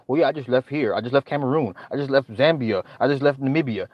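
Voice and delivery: normal talking voice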